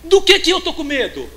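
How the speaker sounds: shouted high, hammering the question; fiery pulpit shout; full-force preaching. Acted. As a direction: preach it out at the top of your voice.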